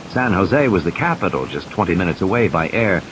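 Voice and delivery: usual speaking voice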